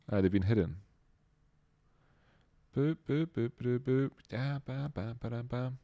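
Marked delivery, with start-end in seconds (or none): 2.8-5.7 quiet, higher sing-song boops and ba-da-bas; soft boop-boop humming. Real, not acted. Streamer killing time while the credits load, quietly humming boops. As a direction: idly hum nonsense boops under your breath.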